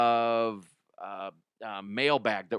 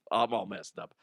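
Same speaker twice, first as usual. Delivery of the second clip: ordinary talk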